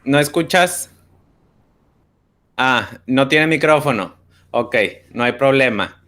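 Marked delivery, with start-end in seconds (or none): none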